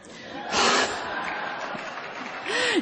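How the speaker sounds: breathless high-pitched giggle; airy high giggle. Real, not acted. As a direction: giggle at her own joke. Jokes about being a chocoholic.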